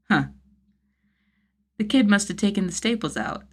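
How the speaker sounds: usual speaking voice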